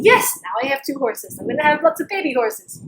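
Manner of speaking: loud, high, acted jubilation; gleeful boasting. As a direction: crow over your good luck, hammed-up. Old man's earlier glee is replayed.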